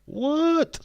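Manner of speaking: very high, loud squawk; shocked disbelief. Real, not acted. Streamer finding a wrestler missing from the list, shocked. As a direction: squeal it in disbelief.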